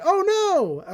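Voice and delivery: drawn-out, high-pitched "oh no"; mock alarm. Caught by security with smuggled snacks, mock alarm. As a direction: cry out high in playful panic.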